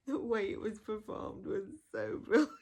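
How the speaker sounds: real delighted laughter; laughing praise. Real, not acted. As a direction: laugh as you rave about it. Laughing while praising the performance.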